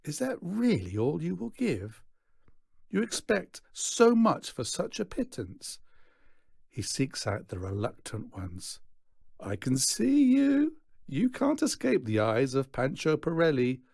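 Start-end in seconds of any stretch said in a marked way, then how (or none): 0.0-5.8 high-pitched, pained reproach; theatrically wounded showman; voiced disappointment. A narrator's reading. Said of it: "He voices his disappointment". 9.4-13.8 high, sing-out call to the reluctant; theatrical you-can't-hide call; showman singling out. A narrator's reading. Showman seeks out reluctant payers.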